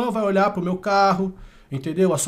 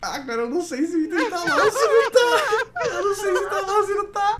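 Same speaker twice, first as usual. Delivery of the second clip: laughing high-pitched words; laugh-crying through the words; trying not to laugh; 11 semitones higher than usual. Real, not acted. Round lost, laughing while talking.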